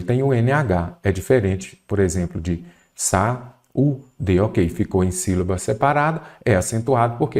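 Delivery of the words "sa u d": "sa, u, d" como "sa-ú-de", pausado; separado em sílabas. Shown as a demonstration. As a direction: diga "sa, u, d" sílaba por sílaba, isolando o U. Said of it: "Quando você separa em sílabas". ("sa, u, d" silabado para mostrar o hiato)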